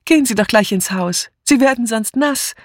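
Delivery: high, cheery calling out; friendly call. A narrator's reading. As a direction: smile and call a greeting across the garden. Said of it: "lächelte sie freundlich und rief". (freundlich gerufen)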